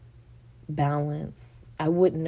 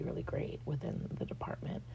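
ordinary talk, nothing marked

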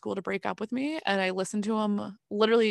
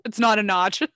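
louder, laughing through the joke; laughing quip; 6 dB louder than usual. Real, not acted. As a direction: tease while cracking up. Friend jokes and laughs.

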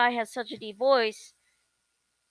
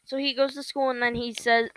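normal talking voice

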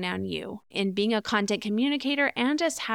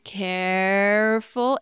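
very slow, drawn-out cautionary careful; warning tone; much slower than usual. Shown as a demonstration. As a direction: stretch it out as a gentle warning. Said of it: "That's why I'm saying".

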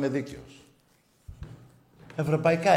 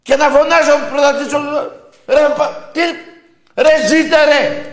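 screamed much higher and louder, mocking rival fans; furious shout; 14 semitones higher, 12 dB louder than usual. Radio host screaming at Panathinaikos fans, furious shout. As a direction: bellow the insults at the terrace, full fury.

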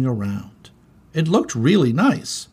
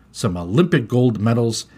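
usual speaking voice

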